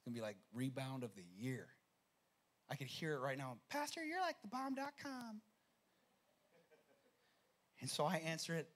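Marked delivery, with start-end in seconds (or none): 3.7-5.4 imagined grateful mother. Retold or imitated.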